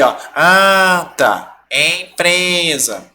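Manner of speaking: mock exam-goers stretching ANTA and EMPRESA nasally; exaggerated slow ANTA, EMPRESA through the nose; imitating students sounding out. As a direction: pretend to test each nasal vowel. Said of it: "todo mundo assim".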